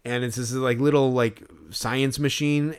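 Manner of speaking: ordinary talk, nothing marked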